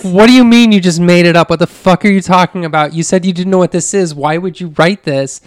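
loud, high furious disbelief; angry yelling. Retold or imitated. Husband yelling at his wife over her confession, furious.

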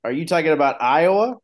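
keyed-up question from a retold call; retold excitement. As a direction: act out answering a friend excitedly. Retelling an excited phone call.

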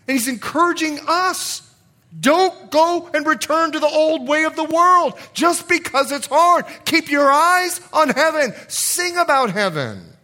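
loud, high, urgent exhortation; full-force preaching. Real, not acted. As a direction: urge the congregation on with full voice.

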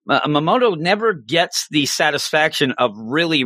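ordinary delivery

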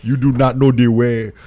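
put-on loud meme Ugandan accent, shouted; Ugandan Knuckles accent. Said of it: "the thickest Ugandan accent".

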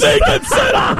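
very high, cracking-up squeal; gleeful mockery. Real, not acted. Co-host relishing the rival's bungled last play, gleeful. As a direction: squeal it on the edge of laughter.

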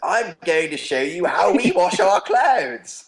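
high, slow, overdone fake English accent like a costumed tour guide. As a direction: ham up a bad fake English accent, drawn out. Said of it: "bad fake English accents".

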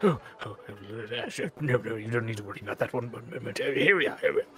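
soft, hurried, nervous fluster; flustered servant. Acted. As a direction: brush them off in a fluster.

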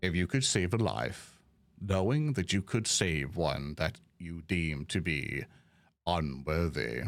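Ferdinand. Acted. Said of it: "he'll look at you all and say".

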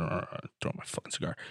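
grumbling. Acted. Angry captain grumbling to himself about his lost cigar, softly muttered. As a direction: mutter it under your breath, annoyed. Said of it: "He's grumbling".

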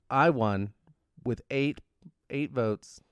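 ordinary talk, nothing marked

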